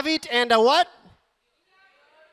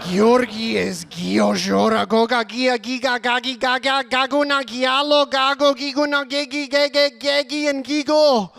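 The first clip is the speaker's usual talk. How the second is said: louder, climbing name barrage; building list; 5 dB louder than usual. Acted. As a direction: build to a crescendo. Climactic rapid list.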